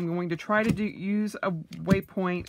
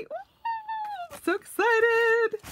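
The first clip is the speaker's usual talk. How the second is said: high, drawn-out squeal of delight; excited squeal; much slower than usual. Real, not acted. Unboxing her new leathers, a high slow excited squeal.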